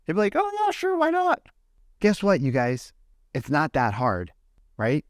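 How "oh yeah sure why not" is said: squeaky, much higher voice of a keen agent; eager mock yes. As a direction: toss it off sky-high, all too happy to help. Imitating agent eagerly agreeing.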